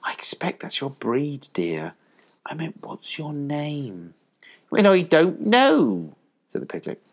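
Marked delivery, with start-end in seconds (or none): 0.0-4.2 soft, slow, gentle motherly voice; kindly. A narrator's reading. Collie asking the piglet his name, kindly. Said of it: "to the piglet she said kindly". 4.7-6.2 high, slow, loud piglet voice. A narrator's reading. Piglet answering kind collie, high and slow.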